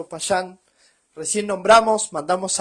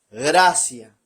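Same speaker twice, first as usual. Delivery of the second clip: gracia dropped low and said louder, with a falling close; stressed word gracia; 6 semitones lower, 6 dB louder than usual. Shown as a demonstration. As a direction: lean hard on "gracia", low and firm. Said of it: "[name] remarca la palabra gracia".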